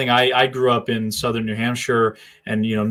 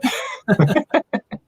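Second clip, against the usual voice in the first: burst of laughter; 12 semitones higher than usual. Real, not acted. Laughing at accent comment.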